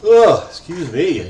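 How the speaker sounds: real yawn through the words. Real, not acted. Gamer yawns mid-session, a drawn-out yawn. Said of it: "yawning a bunch here".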